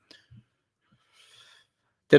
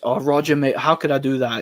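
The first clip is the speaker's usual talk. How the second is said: ordinary talk, nothing marked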